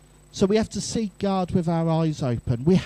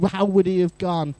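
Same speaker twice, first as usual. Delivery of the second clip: ordinary talk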